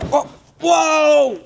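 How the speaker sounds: high, loud startled cry; startled whoa. Real, not acted. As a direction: yelp as something slips. Toolbox slides away.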